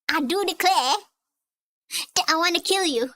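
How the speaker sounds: hammed-up Southern accent, mock threat; badly done Southern accent. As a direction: put on a bad Southern accent. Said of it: "No more badly done southern accent".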